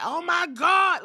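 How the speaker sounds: loud, high, drawn-out fan gasp of shock; hammed-up shocked outcry; mock amazement. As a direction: imitate an overexcited crowd reaction. Mimics everybody's big reaction.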